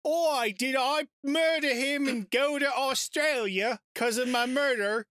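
put-on high, slow Australian accent. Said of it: "killed my Australian accent".

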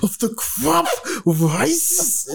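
high, strained, drawn-out wrestler catchphrase; hammed-up wrestling promo impression; Macho Man. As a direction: draw it out slowly, voice straining upward. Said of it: "get my Macho Man voice on".

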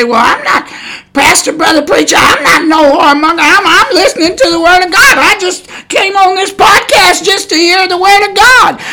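high, pleading whiny objector; mock protest. Preacher mimicking a listener who denies being a whoremonger. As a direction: play the offended listener who insists.

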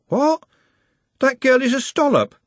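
high-pitched incredulous outburst; shocked disbelief. A narrator's reading. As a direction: react aghast to the bad news. Trev hears the bad news.

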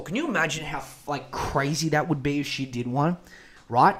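normal talking voice

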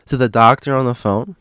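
normal talking voice

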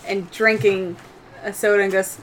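normal talking voice

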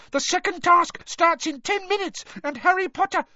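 very high, urgent elf voice; squeaky house-elf urgency; Dobby squeaking. A narrator's reading. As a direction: squeak it out in a panic to hurry him. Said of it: "squeaked Dobby".

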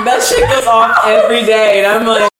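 loud laughter breaking through the words; cackling while talking; laughing hard. Real, not acted. Laughing at her own skipped workout alarm, loud laughter.

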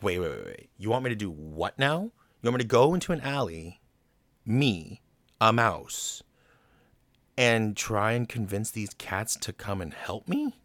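mock disbelief, rising to a question; acted-out incredulous protest, punching key words; reluctant, incredulous. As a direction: balk at an absurd request. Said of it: "of course Roquefort is reluctant".

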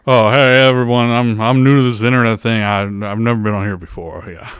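a hint of [name]; Elvis impression. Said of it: "So this is Elvis".

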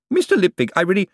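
high prissy cashier voice; Bent, prim cashier. A narrator's reading. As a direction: question the client like a clerk. Bent protests.

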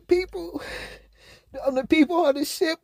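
high, tearful, trying not to cry; holding back tears. Real, not acted. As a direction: voice tight with held-back tears. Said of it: "I'm trying not to cry".

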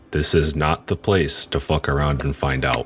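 measured order, each last word set apart; stern reprimand. Acted. Torash catching Pants stealing, sternly controlled. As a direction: lay down the law, pausing between the final words.

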